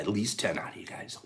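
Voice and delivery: soft unvoiced whisper. Real, not acted. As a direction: whisper it to the viewers. Whispered aside.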